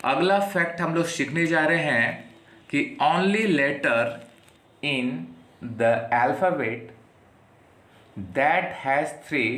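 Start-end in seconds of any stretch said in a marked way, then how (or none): 3.0-9.5 very slow, word by word; slow dictation. Shown as a demonstration. Teacher dictating to students, slow and deliberate. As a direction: read it out slowly so students can write.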